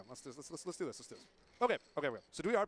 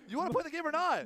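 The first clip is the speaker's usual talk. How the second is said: panicking gamer; 10 semitones higher than usual. Pretend. Mock frustration at co-host.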